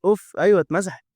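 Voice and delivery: relieved cheer. Real, not acted. Relief when item deleted.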